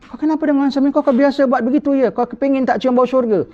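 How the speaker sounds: high, sharp scolding of a daughter; scolding parent. Retold or imitated. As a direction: tell off your daughter, voice pitched right up. (ibu bapa memarahi anak perempuan dengan suara tinggi)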